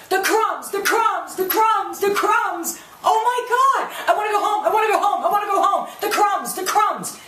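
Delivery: high-pitched mimicry of a fretting woman; mock hysterical whining. Mocking Renee's obsession with crumbs, hammed-up high whining. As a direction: wail it over and over, hammed-up.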